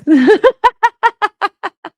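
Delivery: high, loud burst of real laughter; real shrieking laugh. Real, not acted. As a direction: crack up loudly. Laughing at the messages.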